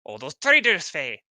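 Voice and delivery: loud, high exclamation of betrayal; angry outburst. Acted. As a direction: spit out the word traitors furiously. Character reacts angrily to gnome smell.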